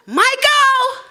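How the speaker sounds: high, loud call up the stairs. Retold or imitated. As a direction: shout a name to someone upstairs. Mother calling husband upstairs.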